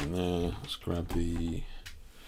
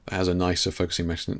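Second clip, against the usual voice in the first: usual speaking voice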